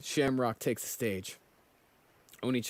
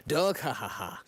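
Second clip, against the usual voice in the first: villain laugh; 4 semitones higher than usual. Acted. Voicing a manga villain laughing.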